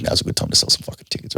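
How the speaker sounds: unvoiced whisper to yourself; whispered thought. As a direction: hush it like a private scheme. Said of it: "I'm like".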